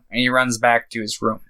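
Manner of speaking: ordinary talk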